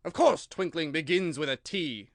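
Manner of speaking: cutting royal reply; sharp king. A narrator's reading. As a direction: snap back impatiently. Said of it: "said the king sharply".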